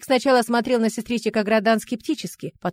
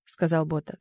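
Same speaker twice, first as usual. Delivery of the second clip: plain reading voice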